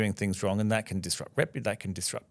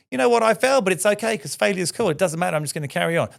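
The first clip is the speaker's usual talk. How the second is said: much higher, louder, breezy carefree mockery; hammed-up don't-care voice, pitched way up; mock nonchalant; 7 semitones higher, 3 dB louder, faster than usual. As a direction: play the shrugging failure who doesn't care. Mocking a careless attitude to failure.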